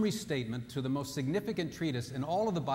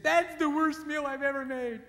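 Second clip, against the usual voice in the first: wife fishing again; 12 semitones higher, 4 dB louder than usual. Retold or imitated. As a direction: moan it like she's fishing for comfort. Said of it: "So when she said".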